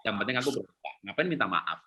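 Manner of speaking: quiet, low dismissive muttering; muttered aside. Retold or imitated. Pragmatic child shrugging off an apology, quiet and low. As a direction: brush it off under your breath.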